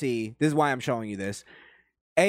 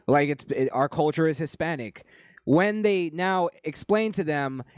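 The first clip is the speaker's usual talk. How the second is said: ordinary talk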